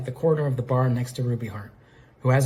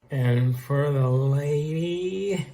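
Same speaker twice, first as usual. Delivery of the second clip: very slow, drawn-out drowsy mocking; sleepy mimicry; much slower than usual. A narrator's reading. Ruby Heart groggily imitates the bartender, sleepy slurred mimicry. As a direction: drag the words out as if half asleep. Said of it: "She imitates him sleepily".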